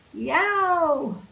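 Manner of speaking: sudden high yelp while falling; loud yell. Acted. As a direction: yelp as you drop into the water. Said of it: "such a loud yell".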